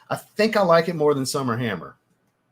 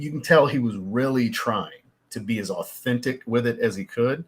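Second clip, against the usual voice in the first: ordinary talk, nothing marked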